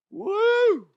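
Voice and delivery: high loud whoop. Real, not acted. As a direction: let out a big cheer. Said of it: "my voice is a little strained".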